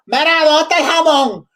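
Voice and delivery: imitating someone asking for ham in Spanish; mock Spanish shopper. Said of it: "they don't go".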